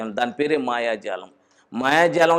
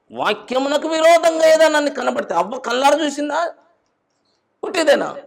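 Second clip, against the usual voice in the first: high, loud pulpit proclamation; raised, ringing sermon voice; full-force preaching; 11 semitones higher, 5 dB louder than usual. Acted. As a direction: preach it out to the congregation.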